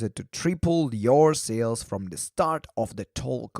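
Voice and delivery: ordinary talk, nothing marked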